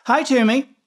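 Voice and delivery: high, loud friendly greeting; calling out. Acted. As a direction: call out cheerfully across the yard.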